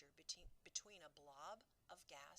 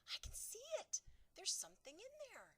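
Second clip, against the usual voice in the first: much higher, louder excited cry; 8 dB louder than usual. Acted. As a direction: burst out in discovery. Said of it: "cried out".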